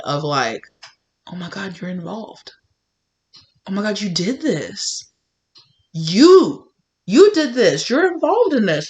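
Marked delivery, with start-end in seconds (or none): 1.3-5.0 quietly stunned as it sinks in; dawning horror. Acted. Horrified realisation in story. 5.9-8.9 higher and louder, building to an accusing cry; rising accusation. Acted. Accusing the betrayer.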